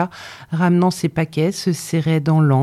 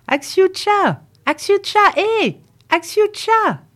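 high, long call across the yard; girl shouting for her sister from the back steps, pitched high; slower than usual. A narrator's reading. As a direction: hail your little sister from the porch. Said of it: "criait à sa petite sœur".

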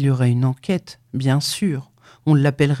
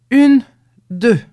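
much louder, higher count shouted out; 8 semitones higher, 8 dB louder than usual. A narrator's reading. Two men in the dark shouting the step even louder. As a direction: belt out the second count. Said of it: "ils criaient".

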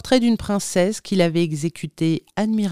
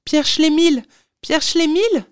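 high, loud excited calling of a name; elated shout after someone; joyful cry; 13 semitones higher, 5 dB louder than usual. A narrator's reading. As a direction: call out the name in delight, pitched way up. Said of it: "m'écriai-je tout joyeux".

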